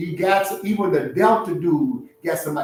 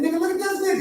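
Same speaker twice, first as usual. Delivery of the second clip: pitched way up in disbelief; high squeal; 12 semitones higher, much faster than usual. Retold or imitated. Telling his boy at the club, high and astonished. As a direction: squeal it out high, like you can't believe your eyes. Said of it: "I said".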